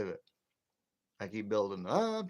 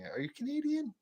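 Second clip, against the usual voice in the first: mock asking. Retold Jersey people asking.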